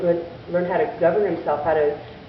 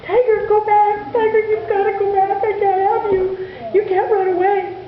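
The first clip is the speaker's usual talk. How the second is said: through tears; 13 semitones higher, 4 dB louder than usual. A narrator's reading. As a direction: send the dog away while crying. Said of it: "Through his tears".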